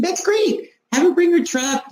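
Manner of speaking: high-pitched, mildly excited yes; eager agreement. Retold or imitated. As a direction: retell an upbeat, enthusiastic reply. Retold eager reply to husband.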